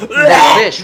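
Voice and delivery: laughing through the words; amused, words breaking into a laugh. Real, not acted. Player laughing at fish pelts.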